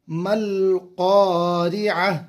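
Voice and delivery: मल क़ारिअ drawn slowly ending on a stop. Shown as a demonstration. Teacher shows students the pausal form, slow chant. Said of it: "जज़म वाली हा".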